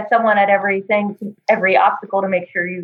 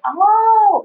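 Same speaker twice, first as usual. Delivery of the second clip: high sudden realization; oh as it dawns on you; surprised oh; 12 semitones higher than usual. Retold or imitated. Realization after explanation.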